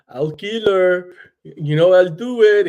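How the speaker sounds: slow, menacing bad Russian accent. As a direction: growl it in a cheesy Russian accent. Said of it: "terrible Russian accent".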